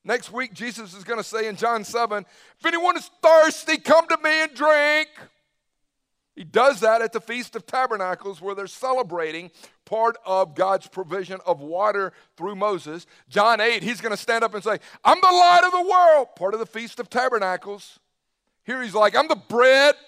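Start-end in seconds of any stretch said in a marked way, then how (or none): 2.6-5.1 higher, louder proclamation; Jesus calling out at the feast, ringing and loud. Acted. 15.0-16.3 high, loud ringing declaration; Jesus standing up at the feast, proclaiming loudly. Acted. 19.2-20.0 declaring. Acted. Jesus declares himself.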